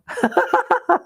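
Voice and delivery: high fond laugh. Real, not acted. Son's size fifteen feet now.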